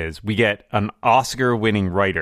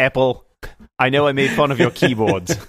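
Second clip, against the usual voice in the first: put-on lightly done Kiwi accent, a bit high; New Zealand accent; 3 semitones higher than usual. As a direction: try a New Zealand accent, half-hearted. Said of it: "The New Zealand accent is hard".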